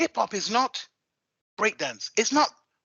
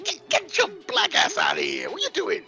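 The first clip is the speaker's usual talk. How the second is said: mock shout; 10 semitones higher than usual. Retold dancers yelling at him.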